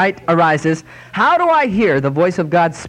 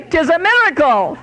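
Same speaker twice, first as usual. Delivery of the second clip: put-on old Irish lady; 12 semitones higher than usual. Old lady praising the saints to the inspector, high Irish brogue. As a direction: stretch it out, much higher, like a pious old Irish woman.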